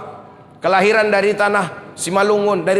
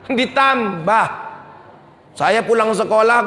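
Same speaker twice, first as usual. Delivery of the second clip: ordinary talk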